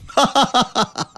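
put-on old man's knowing laugh; hearty laugh. Father-in-law laughs at son-in-law's trouble. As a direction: laugh like you saw it coming.

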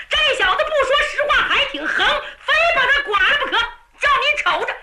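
high, rapid-fire indignation; heated high-pitched threat; angry protest. A narrator's reading. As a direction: argue back hotly, rushing the words. Young general argues back angrily.

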